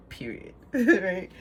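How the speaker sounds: period said through a laugh; chuckling at the pun on period. Shown as a demonstration. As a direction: laugh as the double meaning lands. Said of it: "In both sense".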